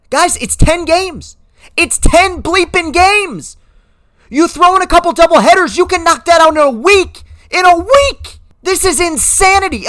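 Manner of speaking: disbelieving outraged vlogger; exasperated rant. Real, not acted. Ranting at owners over ten games.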